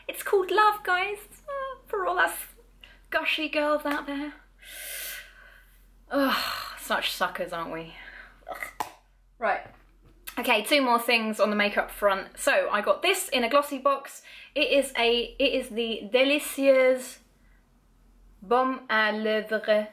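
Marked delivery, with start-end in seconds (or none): 0.1-4.3 gushy. Real, not acted. Gushing about blush name. 15.7-19.9 put-on slow, drawn-out French accent on the product name; French accent, lightly hammed up; mock French accent. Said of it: "I shouldn't have attempted a French accent".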